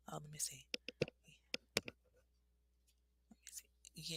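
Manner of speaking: muttered under breath; barely voiced, reading to herself; whispered. Real, not acted.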